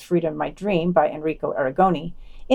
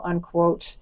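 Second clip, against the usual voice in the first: ordinary talk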